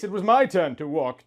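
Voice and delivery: ordinary talk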